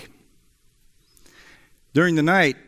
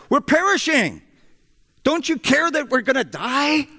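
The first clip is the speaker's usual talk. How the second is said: much higher, frantic cry of terror; panicked shout; 12 semitones higher than usual. Retold or imitated. Disciples waking Jesus in the storm, frantic high cry. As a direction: cry out like men sure they'll drown. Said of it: "they shouted".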